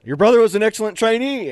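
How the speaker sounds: loud, high imitation of a sergeant; booming accusation, acted out; drill sergeant's shout. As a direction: bark it at the recruit.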